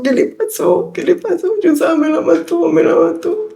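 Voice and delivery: high, softened voice of distress; reliving the panic, pitch climbing high; desperate. Retold or imitated. Distraught daughter to her boss at work, desperate. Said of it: "Yo estaba desesperada".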